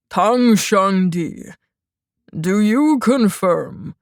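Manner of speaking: a hint of a slow, stern old judge; slow, formal judge addressing the court; lightly voiced judge. A narrator's reading. Judge questions the defendant, slow and lightly stern.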